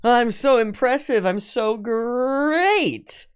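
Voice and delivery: high, slow, booming cartoon-mascot boast; Tony the Tiger. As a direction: stretch it out like a cereal-box mascot. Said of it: "Tony the Tiger style".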